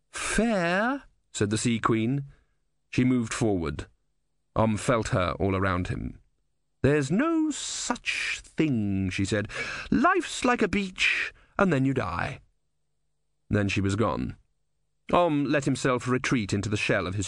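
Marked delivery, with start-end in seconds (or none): 0.1-1.0 high, otherworldly disbelief; scornful sea goddess. A narrator's reading. Sea Queen echoes Om scornfully. 6.8-9.1 high, very slow pronouncement; dismissive sea goddess. A narrator's reading. Sea Queen dismisses fairness. 9.9-12.4 lofty sea goddess. A narrator's reading. Sea Queen's parting pronouncement.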